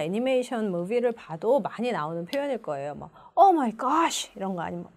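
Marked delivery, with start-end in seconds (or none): 3.4-4.3 higher-pitched demo of astonishment; surprised. Shown as a demonstration. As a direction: exclaim it like a movie character in shock. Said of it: "놀랬을 때 주로 하는". (깜짝 놀라서)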